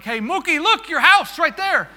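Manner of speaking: high, loud shout to get his attention; excited calling out. Retold or imitated. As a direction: shout it like pointing out a landmark. Pilot calling out to passenger.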